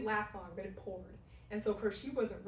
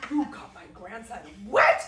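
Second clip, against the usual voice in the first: indignant grandma; 14 dB louder than usual. Retold or imitated. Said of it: "my grandma hackles".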